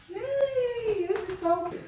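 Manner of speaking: high, soft squeal of joy; delighted squeal. Real, not acted. Delighted on seeing the new apartment.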